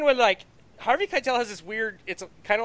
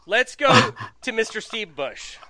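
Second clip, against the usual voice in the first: ordinary delivery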